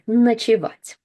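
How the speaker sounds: Ночевать with the first O said as a, stress on -вать. Shown as a demonstration. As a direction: say Ночевать as nachevat. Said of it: "ночевать we pronounce with а".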